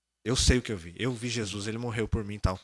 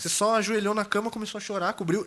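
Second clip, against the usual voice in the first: high imitation of an alarmed girlfriend; mock-panicked recounting; desperate; 9 semitones higher than usual. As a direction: report it in a high, worried put-on voice. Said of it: "ela tava assim, desesperada".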